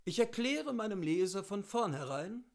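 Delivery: ordinary delivery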